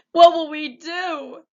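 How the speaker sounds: very high, loud, slow wail. A narrator's reading. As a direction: let the despair break wide open. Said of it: "wailed the cook".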